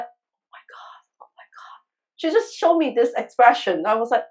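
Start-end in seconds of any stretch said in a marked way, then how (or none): 0.0-1.8 breathy stunned whisper, barely voiced; whispered awe. Friend watching the crystal surgery, whispering in shock. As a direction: mouth it to your friend in shock.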